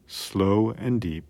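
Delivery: ordinary delivery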